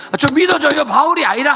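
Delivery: loud, high pleading cry; shouting plea. Acted. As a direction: beg them loudly to believe Paul. Preacher acts out pleading for Paul.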